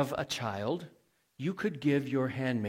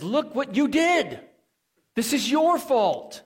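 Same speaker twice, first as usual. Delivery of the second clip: higher and louder, sharp blaming cry; accusing wife; 11 semitones higher, 7 dB louder than usual. Retold or imitated. Sarai rounding on Abram, high loud accusing cry. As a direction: throw the blame at him, loud and high.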